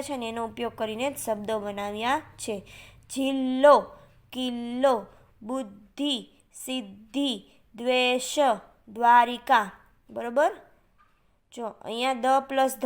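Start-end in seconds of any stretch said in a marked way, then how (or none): 3.1-9.7 one word at a time, syllables held apart; careful word modelling. Shown as a demonstration. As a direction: pronounce each word deliberately for pupils to copy. Teacher models conjunct words for pupils.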